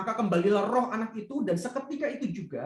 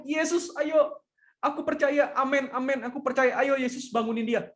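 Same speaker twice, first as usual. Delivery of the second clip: eager mock plea; 6 semitones higher than usual. Eager believer pleading with Jesus.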